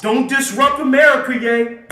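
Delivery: high, loud telling-off; scolding housekeeper. Acted. Housekeeper warning a co-worker not to disrupt, loud scolding. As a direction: shout it as a scandalized order. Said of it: "housekeeper gonna tell him".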